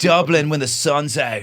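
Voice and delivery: high, slow hail to the city; hammed-up gushing tribute; mock homecoming emigrant.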